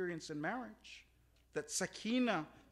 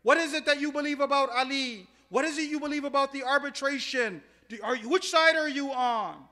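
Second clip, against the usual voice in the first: loud, high-pitched, demanding questions; shouted interrogation; 6 semitones higher, 13 dB louder than usual. Acted. Khawarij interrogating their prisoner, loud and demanding. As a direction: fire the questions out at full voice. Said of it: "they interrogated him".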